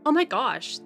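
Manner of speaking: ordinary delivery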